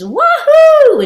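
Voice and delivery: very high, loud joyful "Woohoo!"; excited whoop. Retold or imitated. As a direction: whoop like she's leaping off the platform. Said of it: "she does a hang ten and says".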